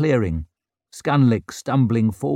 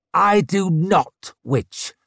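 defiant anger; 8 semitones higher, 3 dB louder, slower than usual. A narrator's reading. Warrior defies the witch.

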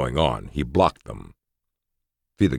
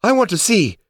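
very high, loud excited cry; eager high voice; 5 dB louder than usual. A narrator's reading. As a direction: shout it, bursting to see. Holly eager, continuing shrill.

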